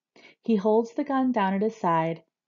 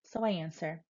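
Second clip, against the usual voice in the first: ordinary delivery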